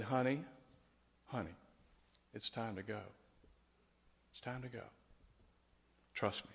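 a soothing voice calling someone home to rest; tender call to go home. Retold or imitated. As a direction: softly reassure her it's time to leave. Savior gently calling the dying woman.

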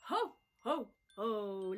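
booming ho ho ho; jolly Santa laugh. Acted. Santa laughing to the friends. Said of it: "laughed Santa".

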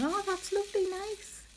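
very high, soft cooing politeness; oblivious approval. Retold or imitated. Instead of admitting she didn't understand, cluelessly sweet. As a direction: coo it high and sweet, mocking yourself. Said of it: "I'm just going".

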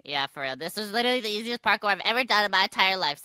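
usual speaking voice

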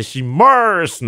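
hammed-up curled R on "しまーす"; American AR sound. Host joking about his authentic accent, exaggerated R in "しまーす". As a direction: stretch "まーす" into English "Mars". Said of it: "エーアールの発音がもう本場".

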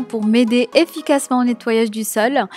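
ordinary talk

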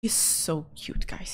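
ordinary talk, nothing marked

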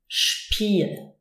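"Spiel" begun with sh, as shpeel. Shown as a demonstration. Teacher giving the example for S P, sh at the start. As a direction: say "Spiel" as sh-piel. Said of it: "the S is actually pronounced sh".

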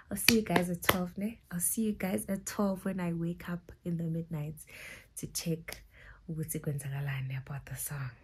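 soft unvoiced whisper. Real, not acted. Whispering goodnight to viewers before the midnight alarm. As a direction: whisper the goodbye.

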